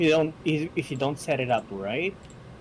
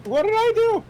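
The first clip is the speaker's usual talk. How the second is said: surprised; 8 dB louder than usual. Real, not acted. Accused of a joke.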